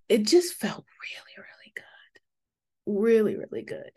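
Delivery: barely holding back real tears while whispering; tearful whisper. Real, not acted. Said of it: "I'm not gonna cry".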